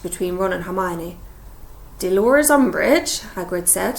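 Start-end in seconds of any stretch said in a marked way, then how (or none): 2.0-3.2 confused. Acted. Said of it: "sounding thoroughly confused".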